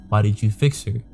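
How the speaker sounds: ordinary talk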